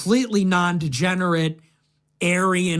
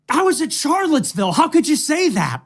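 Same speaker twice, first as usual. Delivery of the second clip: high, fast, indignant protest; mock indignant self; 8 semitones higher than usual. Clip voice mocking himself protesting to far-left critics, high and fast. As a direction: blurt it out, offended, higher pitched. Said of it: "I was like".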